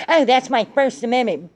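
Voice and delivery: mocking a flag-burner's excuse in a put-on voice; mock whiny excuse. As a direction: sneer it like a smug protester. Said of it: "trying to say".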